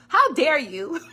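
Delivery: hammed-up affront; loud playful indignation; mock outrage. Repeats offended line.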